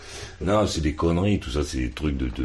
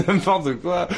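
laughing; 11 semitones higher than usual. Real, not acted. Scoffs at claim, laughing.

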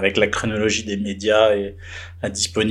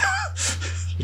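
high laugh; 8 dB quieter than usual. Real, not acted. Laugh after the retold yell.

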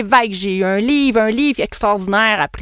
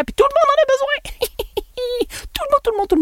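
very high, squeaky mock enthusiasm; squeaky put-on voice of deluded excitement; mocking squeal; faster than usual. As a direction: parody a naive seller in a tiny high voice. Mocks a seller's naive belief.

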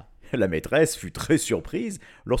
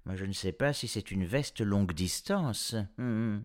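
subdued doubtful musing; sceptical; 4 dB quieter than usual. A narrator's reading. Professor doubting the jacket's range, sceptical. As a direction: voice doubt, ending in a pondering hum. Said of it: "Le professeur Popoff parut sceptique".

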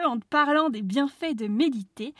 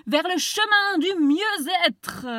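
mock grand voice; 7 semitones higher, 7 dB louder, slower than usual.

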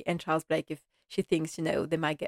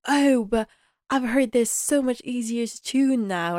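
excited retelling, higher pitched; 6 semitones higher than usual. Retold or imitated. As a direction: gush lightly as an eager young fan. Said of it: "she's so excited".

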